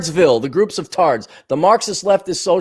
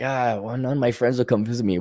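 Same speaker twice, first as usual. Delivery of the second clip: low, soft, fast grumble; lightly voiced self-pity; mock complaint; 6 semitones lower, 7 dB quieter, faster than usual. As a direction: mutter a half-hearted complaint.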